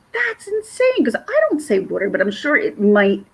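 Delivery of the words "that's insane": high-pitched incredulous exclamation. Retold or imitated. Reacting to a drink rule, incredulous. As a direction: jump up high in disbelief on "insane". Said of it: "I'm like".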